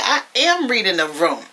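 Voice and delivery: mock-defiant retort. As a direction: clap back, leaning on the verb. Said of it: "Angela climbs back saying".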